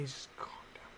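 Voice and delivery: whisper. Real, not acted. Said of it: "telling chatter to calm".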